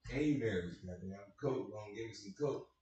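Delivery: ordinary talk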